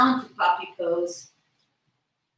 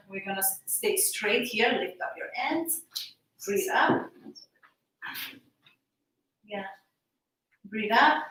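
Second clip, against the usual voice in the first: ordinary delivery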